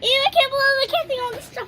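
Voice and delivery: high, loud, fast grossed-out ew; disgusted whine. Real, not acted. Girl near the ant hill whines high and loud in disgust. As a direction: squeal in disgust.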